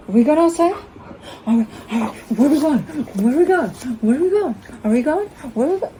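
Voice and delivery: high, gasping tease about going out; mock excited gasp. Owner winding up her dog with mock excitement. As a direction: hype the dog up with fake excitement. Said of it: "I'm fucking with him majorly".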